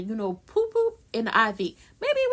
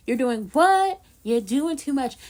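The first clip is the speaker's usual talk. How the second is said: ordinary delivery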